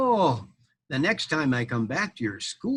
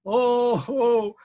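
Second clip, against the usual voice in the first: high, loud startled cry; alarmed oh; 9 semitones higher, 6 dB louder than usual. Acted. Knight seeing the huge dragon, startled. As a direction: gasp it out in fright.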